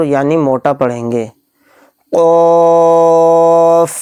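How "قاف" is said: قاف drawn out for three alif counts with a full, thick sound; قاف chanted long and thick; heavy stretched qaf. Shown as a demonstration. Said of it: "اس کے قاف میں فا آئے گا last میں".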